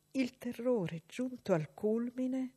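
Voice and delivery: usual speaking voice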